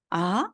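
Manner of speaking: 아 sliding up into a question; questioning rise. Shown as a demonstration. Teacher imitating a reaction to being told something, rising 아. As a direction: lift 아 as if asking back. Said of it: "누가 뭐라고 얘기를 했을 때".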